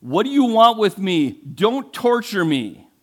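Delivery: high, loud, desperate cry, slowing at the end; terrified pleading. Acted. The possessed man, on his knees, cries out in terror to Jesus. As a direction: beg for mercy in panic. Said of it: "He's terrified".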